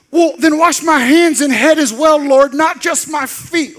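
high, loud, slow hammed-up plea; overeager Peter. Peter begging Jesus to wash him all over, high and loud. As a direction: gush it like a clueless enthusiast. Peter's eager overcorrection voiced.

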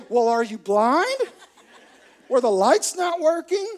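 loud, high mock disbelief; hammed-up baffled alarm; incredulous coworkers. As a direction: play an appalled coworker demanding answers. Coworkers react aghast to appearance.

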